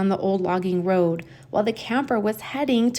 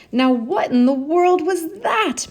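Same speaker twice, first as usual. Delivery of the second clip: high alarmed question; startled McGee; 8 semitones higher, 4 dB louder than usual. Acted. McGee wakes to the splash, high and startled. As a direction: jolt awake and ask. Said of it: "McGee said".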